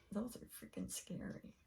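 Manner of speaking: hushed whisper to herself. Retold or imitated. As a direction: breathe it out in a whisper. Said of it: "I'm just like".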